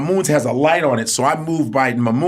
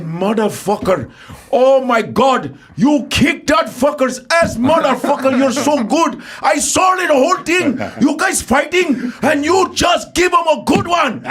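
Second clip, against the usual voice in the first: put-on excited old Indian man; 11 semitones higher than usual. Old Indian man raves about the fight in an excited Indian accent. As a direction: shout it with an Indian accent, amazed at the kick. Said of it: "old Indian man said".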